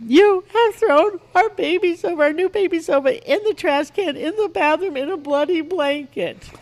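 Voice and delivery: high, loud mock child wail; screaming little girl. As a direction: imitate a toddler shrieking the accusation. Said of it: "she kept screaming".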